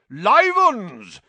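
loud, high cry of fake amazement; mock astonishment. A narrator's reading. Sir Patrick spotting living guests, in mock astonishment. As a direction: boom it out, hugely astonished.

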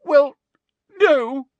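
very high, loud tearful halting; faked distraught weeping; sobbed. A narrator's reading. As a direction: wail it between sobs. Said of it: "sobbed Hermione".

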